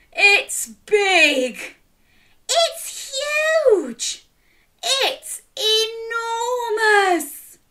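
high, slow amazed enormous. Acted. Storyteller marvelling at the huge turnip, amazed. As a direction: marvel at its size.

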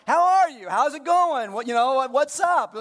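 high, loud, cheery hello; bright greeting. Retold or imitated. Pastor acting out a friend's cheery lobby greeting.

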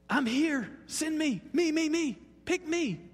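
high, urgent begging to be picked; like an overeager volunteer jumping in; deep emotion, eager. Shown as a demonstration. Preacher acting Isaiah's answer to the Lord, eagerly pleading. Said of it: "There's deep emotion".

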